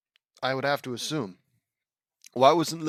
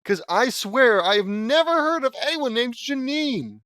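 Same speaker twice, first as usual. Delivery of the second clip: exasperated insistence; 11 semitones higher, 6 dB louder than usual. Real, not acted. Insisting he never heard of her.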